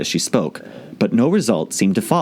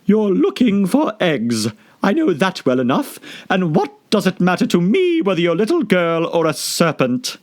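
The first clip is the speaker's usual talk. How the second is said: accusing pigeon; 10 semitones higher than usual. Acted. Pigeon accusing Alice. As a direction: catch her out.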